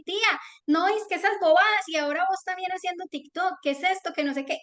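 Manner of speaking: high-pitched, nagging little-niece imitation; mocking niece. Aunt quoting her niece bullying her over TikTok, high mocking voice. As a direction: put on a high scolding kid voice.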